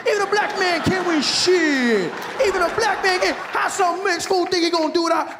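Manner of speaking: high, shouting stand-up comic mocking the candidate; heckling comedian. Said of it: "they'd be like".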